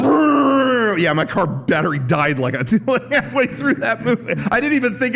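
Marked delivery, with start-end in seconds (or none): 0.0-1.0 high sputtering brrr; imitated engine dying.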